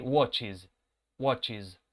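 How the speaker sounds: "Watches" with a ch then "iz"; two syllables, wot-chiz; pronouncing watches. Shown as a demonstration. Teacher drilling the third person form. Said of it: "va a ser watches".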